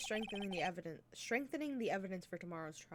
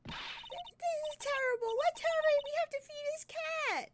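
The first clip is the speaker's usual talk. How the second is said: high squeaky voice. Acted. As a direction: squeak it out like a cartoon. Voicing a squeaky game character.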